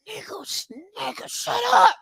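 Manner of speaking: hushed mock retort; whispered mock shut up. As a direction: hiss it under your breath. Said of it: "whispered retort".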